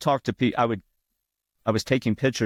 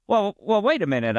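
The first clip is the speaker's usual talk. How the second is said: mock realization; 11 semitones higher than usual. Retold own challenge.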